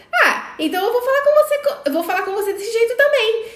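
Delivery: imitating the son's cheeky backtalk; mimicking son's snappy tone. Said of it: "falou comigo no mesmo tom".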